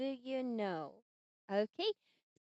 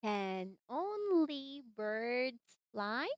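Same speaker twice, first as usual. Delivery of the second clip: slow, even teacher model read; slow model sentence; 3 semitones lower, much slower than usual. Shown as a demonstration. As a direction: say it slowly for the child to copy. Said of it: "say it with me".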